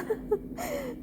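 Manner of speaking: high airy laugh-sob; real crying laugh; tearful laugh. Real, not acted.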